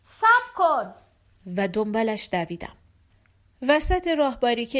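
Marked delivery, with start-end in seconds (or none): 0.0-0.9 higher-pitched, slightly raised call. Acted. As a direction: call after someone to make them stop. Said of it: "صدا زدم". (صدا زدن با لحن کمی بلند)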